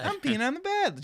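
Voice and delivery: very high gleeful child imitation; happy little kid. Dad imitating his son on the bed, happy little kid. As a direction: chirp it like a kid happy as a lark. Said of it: "Just happy as could be".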